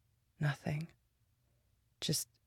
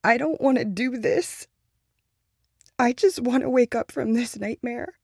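loud, higher, raw with grief; despairing cry, much louder; anguished outburst; 7 semitones higher, 10 dB louder than usual. Acted. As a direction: let the grief burst out loud.

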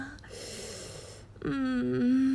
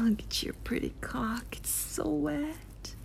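ASMR whisper; 4 semitones higher, 7 dB quieter than usual. Acted. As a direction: lean in close and whisper it. Erotic roleplay, whispered intimacy.

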